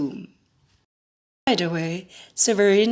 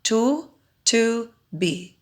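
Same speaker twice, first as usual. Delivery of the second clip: Tu, tu, bi split into separate beats; syllable by syllable; 3 semitones higher, slower than usual. Shown as a demonstration. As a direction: clap out each syllable of tutubi. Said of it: "has three syllables".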